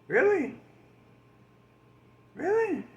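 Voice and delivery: really high, disbelieving Really; incredulous. Real, not acted. Comic asking the crowd in disbelief, high incredulous. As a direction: squeak out the disbelief.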